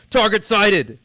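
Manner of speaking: high, very loud soldier's call; acted battle shout. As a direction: shout it like a soldier sighting the enemy. Acting out the soldiers' battle script.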